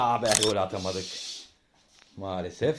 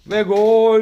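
high, loud goal call; screaming football commentator; 9 semitones higher, 8 dB louder than usual. Acted. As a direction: yell the goal like live TV. Dad commentating home football.

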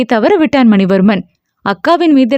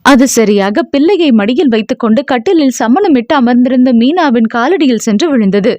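ordinary delivery, nothing marked

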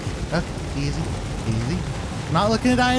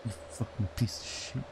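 whispered curse; 10 semitones lower, 14 dB quieter than usual. Real, not acted. Cursing the game character.

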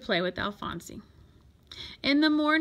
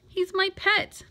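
high, insistent plea; urgent protest; 8 semitones higher than usual. A narrator's reading. Boy defending his pet to the coach, urgent protest. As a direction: stand up for the pet in a higher voice.

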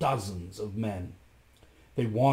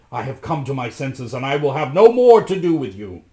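firm, building to loud refusal; defiant declaration; 3 semitones higher, 10 dB louder than usual. A narrator's reading. Rasmus renounces Giulietta, defiance growing louder. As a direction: start firm, then swell loudly. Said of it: "cried Rasmus".

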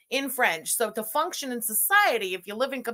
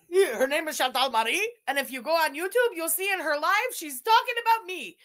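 higher, lilting mock quote; parroting someone with rising ridicule; mocking imitation; 6 semitones higher than usual. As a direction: mimic him derisively, pitch climbing towards the end. Mimics what he told the cops.

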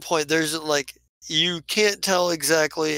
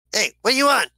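high, loud demand; curt character voice; 7 semitones higher, 5 dB louder, faster than usual. Acted. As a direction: answer loudly, pitched well up. Acting out curt character greeting.

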